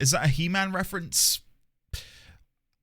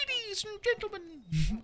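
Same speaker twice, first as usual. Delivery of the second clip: slow, higher, soft showman voice; announcer intro; 13 semitones higher, 9 dB quieter than usual. Acted. Streamer restarting the intro for chat, slow showman voice. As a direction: present it like a ringmaster. Second try at opening announcement.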